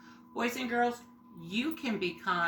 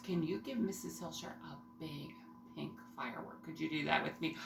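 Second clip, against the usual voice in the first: whispering; 6 semitones lower, 11 dB quieter, faster than usual. Real, not acted. Quiet firework cheer for class.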